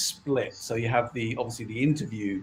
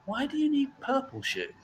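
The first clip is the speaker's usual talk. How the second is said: higher, puzzled shop assistant; 14 semitones higher, 4 dB quieter than usual. Retold or imitated. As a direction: quiz her like a snooty clerk. Shop staff voiced in story.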